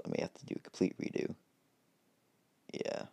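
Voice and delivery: whispered, hurried wrap-up; trailing off quietly. Real, not acted. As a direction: mutter the ending under your breath. Narrator ends video dropping voice.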